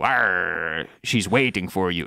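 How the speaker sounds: low, slow mock-pirate growl; weird pirate voice. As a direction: imitate a creepy pirate, drawn out and deep. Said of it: "with a weird, like, pirate voice almost".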